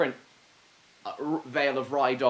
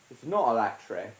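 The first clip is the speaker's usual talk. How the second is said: ordinary talk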